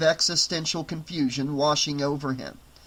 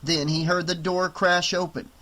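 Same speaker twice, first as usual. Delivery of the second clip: plain reading voice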